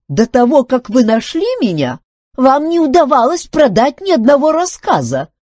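high, loud, aggrieved objection; offended retort. A narrator's reading. Filbert objects to Duncan taking the credit, offended. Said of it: "обиженно возразил Филберт".

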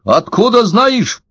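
high, drawn-out indignant demand; narrated high-voiced indignation; indignant old man. A narrator's reading. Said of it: "возмутился старик".

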